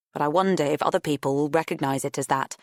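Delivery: ordinary delivery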